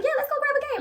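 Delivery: high-pitched, eager yes; mock-squeaky enthusiastic reply; eager agreement. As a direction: reenact jumping at the idea. Acting out her own eager agreement.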